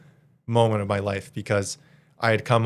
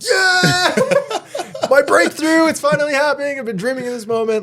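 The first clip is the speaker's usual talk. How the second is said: much higher and louder, laughing with giddy excitement; overjoyed; 5 dB louder than usual. Retold or imitated. Retelling his reaction to his breakthrough, giddy high-pitched excitement. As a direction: squeal it out like your big break just landed. Hangs up after big offer.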